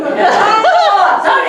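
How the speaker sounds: very high loud laughing "Oh"; laughing panic cry. Retold or imitated. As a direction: squeal it out, laughing. Friend panics again.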